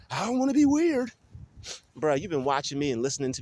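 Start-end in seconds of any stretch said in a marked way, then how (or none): 0.1-1.1 high-pitched mocking imitation; mock excuse. Vlogger mimicking people's excuses, high mock voice.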